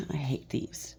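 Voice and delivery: whispered aside; whispering. Real, not acted. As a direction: whisper it under your breath. Aside about thieves.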